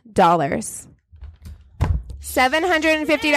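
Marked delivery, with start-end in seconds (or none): 2.2-3.3 higher, stressing hundred; incredulous. Real, not acted. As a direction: disbelief at the number. Incredulous repeat of the sum.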